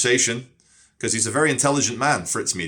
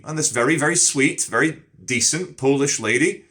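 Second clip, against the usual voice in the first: ordinary talk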